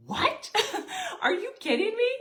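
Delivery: a stunned husband laughing in disbelief; shocked disbelief. Retold or imitated. Husband shocked she knew Amway.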